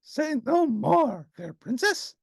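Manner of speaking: high, slow, grandly gallant furry monster; gallant Grover. A narrator's reading. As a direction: pledge your service like a storybook knight.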